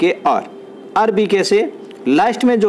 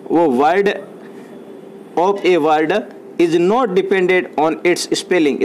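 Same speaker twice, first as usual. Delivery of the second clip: very slow, word by word English; dictation pace; 2 semitones higher, much slower than usual. Shown as a demonstration. Teacher dictating a definition to students, very slow. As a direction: read it out slowly so they can write. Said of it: "repeating dictation".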